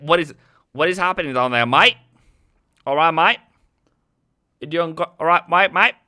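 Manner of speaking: put-on Australian accent. Host greets Aussie viewers in a mock Australian accent. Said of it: "That's both my Aussie".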